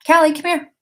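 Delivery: high, bright call to a pet; calling the dog. Real, not acted.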